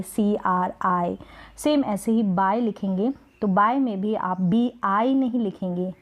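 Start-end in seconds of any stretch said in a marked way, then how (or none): 0.0-1.2 सी आर आई said slowly, letter by letter; spelled out slowly. Shown as a demonstration. Teacher to students, spelling out the wrong version. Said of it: "आप कभी भी नहीं लिखेंगे".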